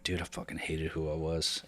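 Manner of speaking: low, soft murmur of self-loathing; quiet late-night confession to himself; hushed regret. Retold or imitated. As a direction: say it to yourself alone at night.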